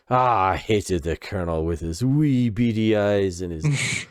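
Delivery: put-on lightly Scottish old dad, rising high at the end; a hint of a Scottish brogue, griping about the Colonel; mild Scottish accent, scornful; Scottish dad impression. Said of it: "his dad is this Scottish guy".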